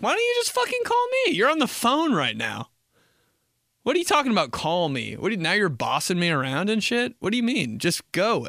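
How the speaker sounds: high-pitched indignant ranting; acted exasperation. Ranting at whoever texted call me, exasperated. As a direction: rattle it off, offended at being bossed around.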